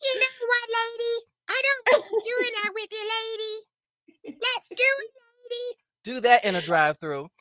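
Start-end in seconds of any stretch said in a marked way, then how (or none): 0.0-5.8 very high, squeaky comic voice; put-on character voice. Shown as a demonstration. Friend doing a drive-through bit, very high comic voice. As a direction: push it way up into a cartoon squeal. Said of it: "when I hear it and I can go".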